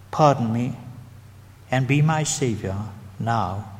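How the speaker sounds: slow, spaced prayer with long pauses; slow prayer line for the congregation to repeat; said slowly. Shown as a demonstration. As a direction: say each phrase slowly for them to echo. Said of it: "Now I'm going to say it slowly".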